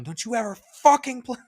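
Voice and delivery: high, loud mock menace; mock threat. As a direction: threaten her like a gangster. Imagined customer threat, joke.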